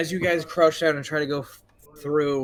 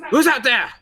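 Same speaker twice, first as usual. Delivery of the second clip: high, loud challenge; raised voice hailing someone out of sight; Shadarkai calling out; 10 semitones higher, 9 dB louder, faster than usual. Acted. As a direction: call out sharply to unseen intruders. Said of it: "voice from an Shadarkai you had heard".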